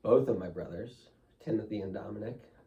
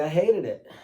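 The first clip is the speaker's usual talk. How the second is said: laughing; 7 semitones higher, 10 dB louder than usual. Retold or imitated. Imagined reply, laughing.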